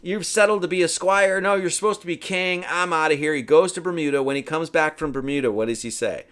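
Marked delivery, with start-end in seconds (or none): 0.0-3.3 higher, mock-indignant scolding; imitated anger. Merlin disappointed in his squire pupil, mock anger. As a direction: play a fed-up mentor telling off a pupil. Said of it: "But he's pissed off".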